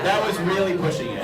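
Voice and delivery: ordinary talk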